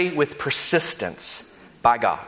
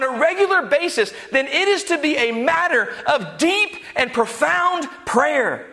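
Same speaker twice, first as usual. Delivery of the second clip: raised, driving sermon voice, higher pitched; full-force preaching; 10 semitones higher than usual. Acted. Pastor urging the congregation to pray, preaching at full force.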